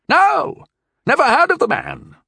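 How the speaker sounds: put-on very high, loud old man's voice; panicked old knight, high and loud; insisting old knight. A narrator's reading. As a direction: protest it urgently. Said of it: "the knight insisted".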